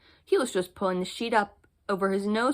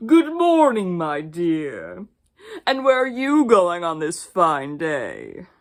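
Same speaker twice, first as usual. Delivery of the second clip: loud, falsely polite wolf voice; 3 semitones lower, 7 dB louder, slower than usual. Acted. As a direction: greet her with sly, oily politeness. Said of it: "and said politely".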